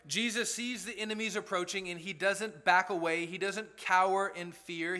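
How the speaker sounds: ordinary talk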